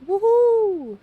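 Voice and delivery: loud, high whoop of delight; real excited shout; joyful whoop. Real, not acted. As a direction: cheer it out at the top of your voice. Cheering after fishing catch.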